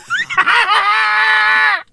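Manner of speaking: high shrieking laugh. Real, not acted. Vlogger laughing at the feud, high shriek. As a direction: burst out in a high cackle.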